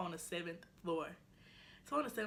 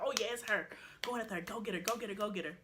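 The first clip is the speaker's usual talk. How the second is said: imitated urging; 3 semitones higher, faster than usual. Group spotting the suspect, excited retold urging. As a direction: act out rallying friends into a chase. Group urges each other to chase.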